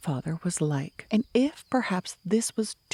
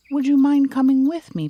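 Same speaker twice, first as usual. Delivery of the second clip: louder plea; 8 semitones higher, 8 dB louder than usual. Acted. Pooh asking nervously.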